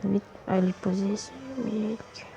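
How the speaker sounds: soft slow hurry; hushed. Real, not acted. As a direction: quietly urge it along. Hushed urgency. (murmure pressé)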